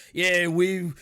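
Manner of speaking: rough attempt at a Cockney orc accent. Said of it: "I'm not gonna do that accent".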